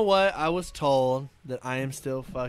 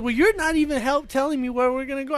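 upset protest; 9 semitones higher, 6 dB louder than usual. Retold or imitated. Imitated passenger arguing back.

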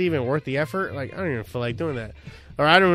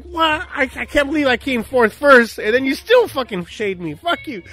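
higher and louder, indignant squeal; hammed-up fury at his wife; mock outraged yell; 10 semitones higher, 8 dB louder than usual. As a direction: blow up in comic outrage. Retold flare-up at wife.

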